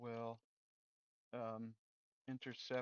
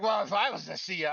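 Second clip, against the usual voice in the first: higher and louder, carried away; excited daydream; 7 semitones higher, 11 dB louder than usual. Retold or imitated. Developer imagining himself as CEO, excitedly louder. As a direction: dream big out loud, much louder. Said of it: "sorry, I'm getting all excited".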